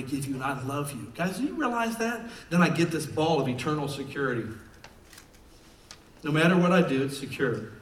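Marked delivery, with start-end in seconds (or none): none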